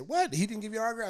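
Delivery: mother outraged on her son's behalf; shocked mom. Retold or imitated. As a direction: gasp it out in disbelief.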